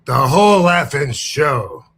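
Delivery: slow booming ring intro; announcer voice. Shown as a demonstration. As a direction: drag out the name like a ring announcer. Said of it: "How'd that work out for you".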